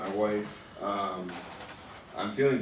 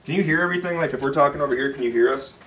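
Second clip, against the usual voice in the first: raised voice; 4 semitones higher, 7 dB louder than usual. Real, not acted. Calling out to remote listeners.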